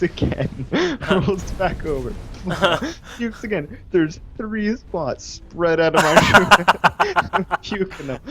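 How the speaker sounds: slow, laughing through words. Real, not acted. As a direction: laugh while you tell it.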